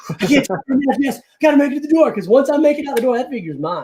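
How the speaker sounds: high, rushed, puffing between words; acting winded from a hurried getaway; out of breath. Buyer on the phone while huffing to the door, out of breath. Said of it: "I'm out of breath".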